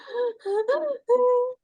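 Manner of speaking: high whiny dog cry, playfully mimicked; imitating the dog's whimper. Teacher shows the class how her dog cries outside, high whimper. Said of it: "它就这样".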